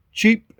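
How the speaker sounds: "cheap" pitched high and isolated; stress on cheap. Real, not acted. Owner repeating his point about seat covers, "cheap" stressed high. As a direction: punch "cheap" up high as its own word. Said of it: "again, emphasize that word".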